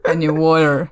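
American WATER with a tapped T and R; American pronunciation. Shown as a demonstration. Contrasting it with the British version, American accent. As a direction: say WATER the American way, sounding the final R. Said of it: "огромное отличие от американского произношения".

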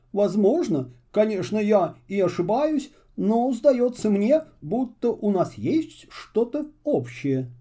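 a courteous stranger, drawn out toward the end; Muffle voice. A narrator's reading. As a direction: reader lightly voicing a polite traveller.

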